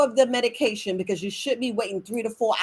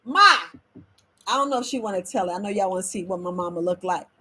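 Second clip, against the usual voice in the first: ordinary delivery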